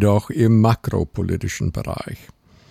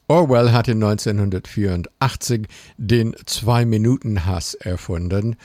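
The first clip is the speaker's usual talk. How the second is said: ordinary talk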